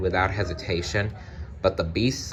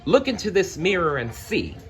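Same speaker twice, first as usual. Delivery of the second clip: challenging; 10 semitones higher than usual. Acted. Belle challenges the crowd.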